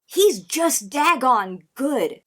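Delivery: "he's just daggone good" slow, high and weighted; emphatic praise. Real, not acted. Insists on the rapper's talent.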